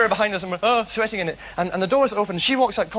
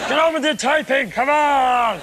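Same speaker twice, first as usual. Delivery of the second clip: louder, higher mock bark at the typist; mock shout; 5 semitones higher, 6 dB louder, slower than usual. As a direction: yell it like a big gorilla boss. Mock King Kong shouting at typist.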